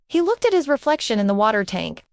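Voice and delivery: plain reading voice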